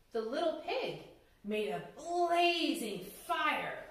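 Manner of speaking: usual speaking voice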